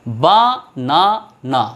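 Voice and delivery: বা - না - না in three separate beats; even pauses between বা, না and না; syllable by syllable. Shown as a demonstration. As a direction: sound out বানানা one syllable at a time. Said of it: "তিনবার stroke করছে".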